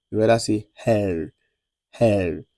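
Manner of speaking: her said with the R from the back of the throat; throaty French R. Shown as a demonstration. Teacher modelling the French R for students. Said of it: "from the back of the throat".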